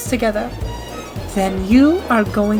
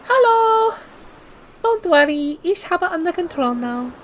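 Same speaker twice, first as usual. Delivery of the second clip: a worn-out German liner putting on a brave face; German accent. Acted. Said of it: "Where are you from? Deutschland?".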